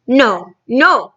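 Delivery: loud protesting cry. Acted. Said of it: "cried the B F G".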